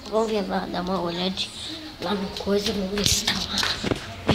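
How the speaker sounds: ordinary talk, nothing marked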